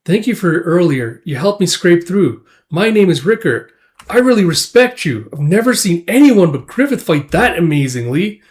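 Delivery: earnest admirer. Retold or imitated. Rickert thanks Guts for helping him, in eager admiration.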